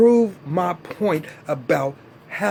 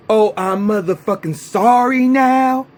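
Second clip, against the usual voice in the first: louder, sarcastic fake sorry; mock apology; 5 semitones higher, 6 dB louder than usual. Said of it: "what you think you just gonna be".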